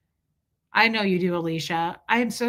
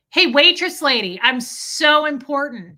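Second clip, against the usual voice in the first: loud, higher-pitched hammed-up boast; mock self-importance; 5 semitones higher, 7 dB louder than usual. Mocking husband's boast to a waitress, loudly self-important. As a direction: call out like a pretend big shot.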